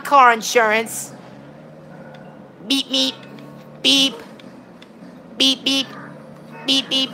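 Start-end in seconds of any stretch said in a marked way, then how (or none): 2.7-7.1 short, even horn beeps; imitated beeping. Gamer driving in the game, imitated beeping. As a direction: mimic a little car horn.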